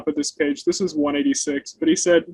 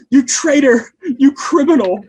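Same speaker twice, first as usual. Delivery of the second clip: loud, higher indignant outburst at the servant; hammed-up furious accusation, louder and higher; angry master; 7 semitones higher, 5 dB louder than usual. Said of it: "And the master butts in".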